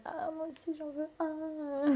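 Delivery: high, soft, drawn-out mock plea; imitating eager women. Directrice and daughter wanting a tattoo, imitated in a high, soft voice. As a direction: squeal it softly, stretching the last words. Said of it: "elles étaient en mode".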